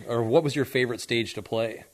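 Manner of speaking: ordinary delivery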